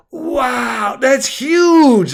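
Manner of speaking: put-on high, wowed kid voice; impressed child. A narrator's reading. Child hearing about a big house, amazed kid voice. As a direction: marvel like a ten-year-old. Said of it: "the same child said".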